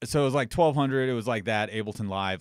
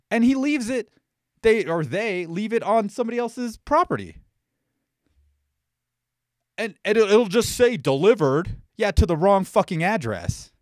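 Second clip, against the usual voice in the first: indignant rant, high and louder; heated; 8 semitones higher, 5 dB louder than usual. Real, not acted. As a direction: spit out the complaint in real exasperation. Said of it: "I'm getting a little heated".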